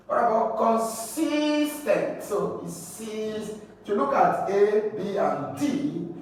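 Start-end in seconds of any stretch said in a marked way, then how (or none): 0.6-2.2 consistent with SIS long and high; slow teaching con-SIS-tent; stress on second syllable. Shown as a demonstration. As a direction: stretch and lift SIS in consistent. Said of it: "stress on the word second syllable".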